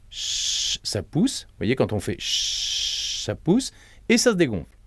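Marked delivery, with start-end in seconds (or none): 0.1-0.8 soft unvoiced "Chut" hissed out long; shh hiss. Shown as a demonstration. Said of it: "expirer avec le son che". 1.7-3.2 soft hiss. Shown as a demonstration. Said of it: "expirer avec le son che".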